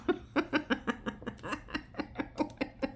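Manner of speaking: breathy laugh. Real, not acted. Laugh after son's line.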